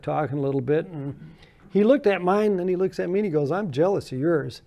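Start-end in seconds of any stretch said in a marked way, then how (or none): none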